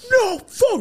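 very high strangled exclamation; squeaky overwhelmed. Retold or imitated. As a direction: squeak it out in disbelief. Overwhelmed after walking off.

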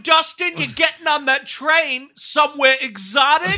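high shouted grandpa call; shouted old man. Retold or imitated. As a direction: yell after him like a wild grandpa. Christopher Walken character calling out.

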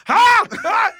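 loud, high-pitched yelps of terror; two explosive re-enacted shrieks; panicked scream. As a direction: scream as if your face is burning. Retold panic after the explosion.

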